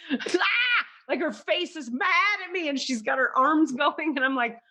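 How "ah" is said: a high wordless mock shriek; high mock scream. Teller imitating the angry lady shrieking through a window. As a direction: imitate an angry woman yelling unheard. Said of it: "like she's shouting".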